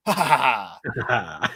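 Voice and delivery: hammed-up evil laugh; villain laugh. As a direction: cackle like a Speed Racer villain. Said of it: "he's like".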